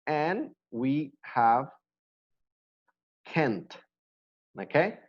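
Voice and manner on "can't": "can't" with a crisp final T, falling; clear can't. Shown as a demonstration. Teacher modelling the negative for students, crisp T. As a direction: end "can't" on a sharp T. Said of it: "I want to emphasize in the pronunciation".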